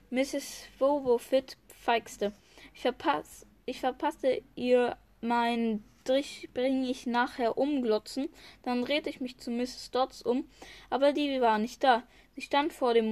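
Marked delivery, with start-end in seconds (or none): none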